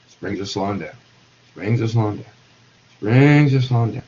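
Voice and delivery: low, measured repeats that gradually build in volume; slowing down. Shown as a demonstration. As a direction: act out springs winding down, with gaps between repeats. Acting out springs slowing as water cools.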